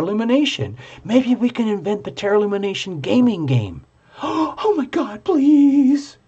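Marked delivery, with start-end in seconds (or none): 4.0-6.2 gasping, much higher, pleading please; thrilled by her own idea; excited gasp. Real, not acted. Host dreams up a tarot game, excited gasp.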